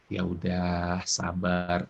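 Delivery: low, soft "be patient"; low-frequency calming. Shown as a demonstration. Parent answering an angry child, low and soft. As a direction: soothe from underneath, pitch and volume dropped. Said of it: "ini frekuensi di bawah".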